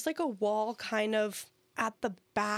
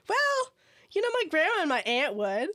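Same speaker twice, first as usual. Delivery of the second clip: high awkward quote; 6 semitones higher than usual. Retold or imitated. Retelling her reply to customer.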